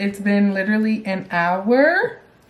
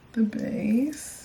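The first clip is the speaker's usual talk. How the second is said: whispering; 6 dB quieter than usual. Real, not acted. Whispered aside.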